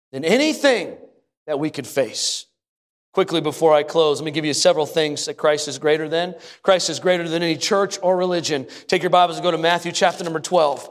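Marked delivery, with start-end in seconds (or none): none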